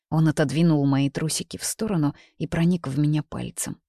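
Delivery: ordinary delivery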